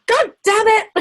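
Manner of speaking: high, loud, hammed-up exasperated cry; mock frustrated outburst. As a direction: burst out like you've been caught out, half laughing. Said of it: "And you're like".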